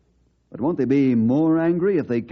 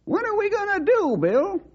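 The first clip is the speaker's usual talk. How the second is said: put-on worried old sidekick. Sidekick asks Bill.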